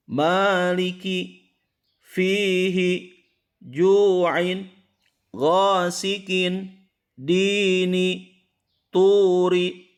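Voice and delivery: slow, high chanted reading of Arabic words; a teacher chanting a tajwid example list; slow Quran recitation. Shown as a demonstration. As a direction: recite each word with long vowels drawn out. Teacher recites the full word list.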